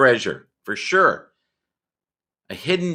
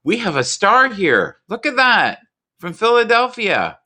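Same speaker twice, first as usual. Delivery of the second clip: high, loud star-struck intro; excited announcement; 10 semitones higher, 3 dB louder than usual. Real, not acted. Introducing a star guest to the audience, excited. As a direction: hype up the guest.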